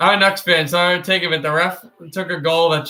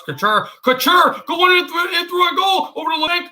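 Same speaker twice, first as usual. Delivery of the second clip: play-by-play climbing high and excited; Mark Moser; 10 semitones higher than usual. Acted. Streamer imitating a broadcaster on a Sharks rush, excited high call. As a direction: build urgently as the puck nears goal. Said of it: "you want me to do Mark Moser".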